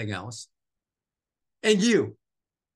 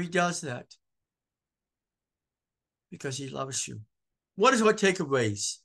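ordinary talk

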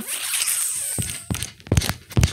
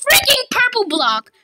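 frustrated yell; 11 semitones higher, 6 dB louder than usual. Real, not acted. Raging gamer yelling at game.

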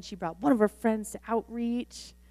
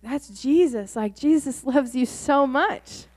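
louder, joyful and slower; excited; 3 semitones higher, 7 dB louder than usual. Retold or imitated. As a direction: tell her with glowing excitement. Excited joy after healing.

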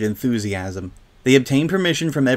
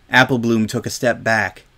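usual speaking voice

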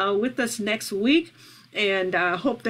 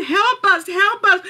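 higher pleading call; imitated cry for help; 9 semitones higher, 7 dB louder than usual. Praying for trapped prisoners, imitated crying out. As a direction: voice a prisoner's plea, raised in pitch. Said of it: "they're crying out and they're saying".

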